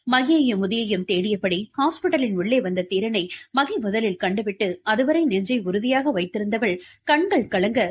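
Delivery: ordinary delivery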